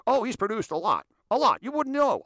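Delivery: high, loud mock hype; exaggerated name-dropper's boast; gushing brush-off. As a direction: oversell him loudly, pitch way up. Acts out defensive hype reply.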